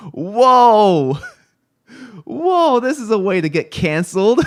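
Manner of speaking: loud, high startled whoa, then rushed disbelief; shocked whoa. Retold or imitated. Streamer retelling first seeing Bosco's picture, loud shocked whoa. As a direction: gasp it out like you just saw something you shouldn't. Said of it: "I was like".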